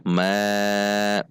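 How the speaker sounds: "ma" drawn out with thin, open a; slowed, tongue low. Shown as a demonstration. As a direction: hold "ma" long, tongue root down. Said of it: "Jika saya lambatkan". ("ma" dilambatkan, pangkal lidah turun)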